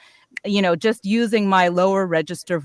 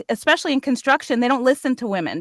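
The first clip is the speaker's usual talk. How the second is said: normal talking voice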